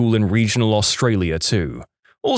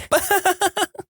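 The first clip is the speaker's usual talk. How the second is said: high mocking giggle. Mocking laugh after quote.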